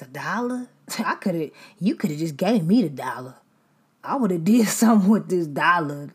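loud, indignant ungrateful friend; an ungrateful friend ranting over a kind gift, loud and aghast; mock ungrateful complainer. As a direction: complain about a gift, hammed up. Ungrateful receiver voiced.

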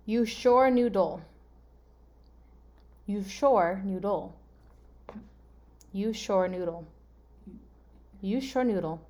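slow, word-by-word puzzle reading; deliberate, spaced-out readout; gibberish readout. Shown as a demonstration. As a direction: read each word separately, as if unrelated. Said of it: "I'm gonna read them to you".

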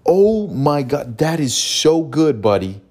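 high, slow thrilled praise; excited praise. Shown as a demonstration. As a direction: gush like you won the lottery. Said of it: "you react like you just won a hundred million dollars".

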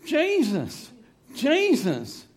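high-pitched, acted begging cry; pleading. Demon begging Jesus, high pleading cry. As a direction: plead loudly from a high pitch, falling away. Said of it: "He started pleading".

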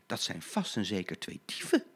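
soft, hushed worried aside; a mild hint of frightened tram whispering to herself; frightened thought. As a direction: fear to yourself. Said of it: "dacht Blauw Katrientje".

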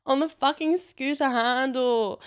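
normal talking voice

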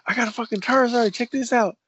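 high, excited bragging to his brothers; retold brag to brothers, high and excited. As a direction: show off the card, thrilled. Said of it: "I was like".

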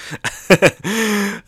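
high giggling fit; real laugh. Real, not acted. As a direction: crack up at the gag. Laughing at the gag.

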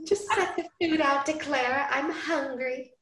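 high, bright Southern belle lilt; lightly Southern heroine asking sweetly; Southern accent. Acted. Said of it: "I don't know why I'm going Southern with her".

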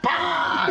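high, explosive "pa!" impact; golpe imitado. Retold or imitated. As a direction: shout the sound of a body hitting the ground. (golpe imitado, agudo y explosivo)

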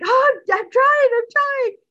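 high, loud, flustered cry; acted frantic protest. Girlfriend answering Chris's shouting as she hunts keys, frantic protest. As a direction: shoot up in pitch and protest loudly.

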